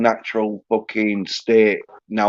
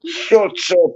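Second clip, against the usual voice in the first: higher, scoffing comeback; dismissive retort; 9 semitones higher than usual. Acted. Telling a squeaky prank caller off, dismissive. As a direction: brush off the silly caller.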